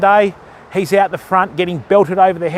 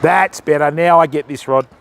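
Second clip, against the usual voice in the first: ordinary talk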